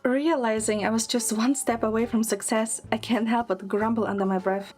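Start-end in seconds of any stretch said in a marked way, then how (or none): none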